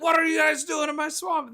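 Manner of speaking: high hammed-up shout at the fairy tale squatters; angry ogre. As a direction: yell it at the intruders. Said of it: "he's like".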